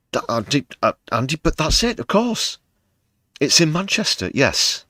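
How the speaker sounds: high, loud fake eureka moment; mock realization. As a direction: act out a sudden sarcastic discovery. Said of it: "This is all sarcasm".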